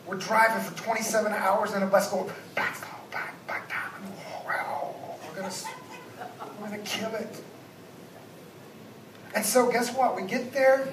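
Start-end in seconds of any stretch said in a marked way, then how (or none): 2.5-7.4 hushed, eager whispering; excited whisper. Retold or imitated. Kids on the bus whispering about the hardest slopes. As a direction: whisper it like a thrilling secret.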